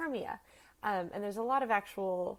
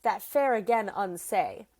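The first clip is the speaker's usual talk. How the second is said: louder, lightly acted Shakespearean retort; Helena retorting; 2 semitones higher, 6 dB louder, slower than usual. Helena turns around to answer Hermia, lightly acted retort. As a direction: turn on her and throw it back, projected. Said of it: "Helena turns around and says".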